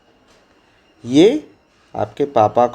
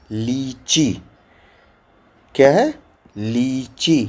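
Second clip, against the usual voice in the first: ordinary talk, nothing marked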